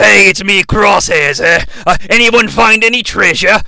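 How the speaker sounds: put-on character voice; Crosshairs. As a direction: play an oddball treasure hunter introducing himself. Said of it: "I sound like this".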